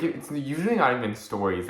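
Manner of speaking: ordinary talk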